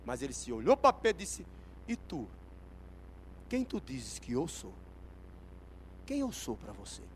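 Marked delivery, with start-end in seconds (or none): 1.9-7.0 low, softer searching question; changed, intimate intonation. Acted. Jesus turning to Peter with a question, low and intimate. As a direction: lower your voice and ask it personally. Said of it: "de repente mudou a entonação".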